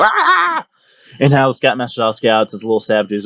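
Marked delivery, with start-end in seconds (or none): 0.0-0.7 zombie cry. Retold or imitated. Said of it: "dogpiling some of the older kids and go".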